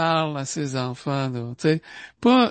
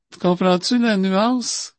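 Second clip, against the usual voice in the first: normal talking voice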